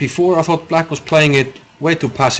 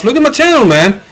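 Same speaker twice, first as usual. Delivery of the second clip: excited plug; 11 semitones higher, 6 dB louder than usual. Real, not acted. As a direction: pitch jumps way up, louder, hyped. Hyping his channel to viewers.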